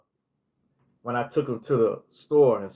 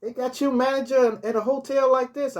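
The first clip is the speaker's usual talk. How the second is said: much higher put-on voice of the man; the man surprised he's hotel manager, much higher put-on voice; imitating him, surprised; 10 semitones higher than usual. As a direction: play his mild surprise, pitch well up. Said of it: "He said".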